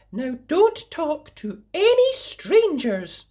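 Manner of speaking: high, slow motherly caution; mummy's warning. A narrator's reading. Mother warning her daughter before the walk, high and slow. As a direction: warn her gently like a mum. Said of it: "said Mummy".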